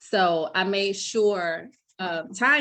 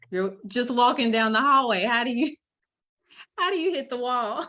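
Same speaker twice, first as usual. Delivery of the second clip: higher, laughing disbelief; baffled; 5 semitones higher, 5 dB louder than usual. Retold or imitated. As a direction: retell her bewilderment, amused and incredulous. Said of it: "I thought it was the weirdest thing".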